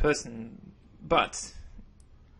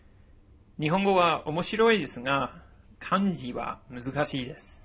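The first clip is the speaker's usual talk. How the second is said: model sentence read; 3 semitones higher, 3 dB louder than usual. Shown as a demonstration. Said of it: "I'm gonna give you an example".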